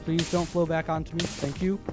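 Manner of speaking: ordinary talk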